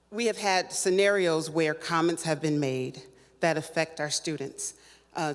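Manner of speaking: ordinary talk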